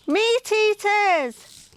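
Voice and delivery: high, slow frightened cry; scream. Acted. Sid spots the meat eaters, high frightened cry. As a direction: cry out the danger, high and drawn out. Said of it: "screamed Sid".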